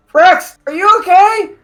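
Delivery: high, loud call across the battle; shouting over. Acted. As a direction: shout over to a hurt friend. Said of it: "As Ivy shouts over".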